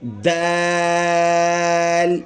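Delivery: "دال" with a very long aa on one level note; elongated letter. Shown as a demonstration. Teacher showing how to read the letter, long and steady. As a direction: stretch the aa of "دال", then close on l. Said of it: "ہم نے ایسے تلفظ کرنا ہے".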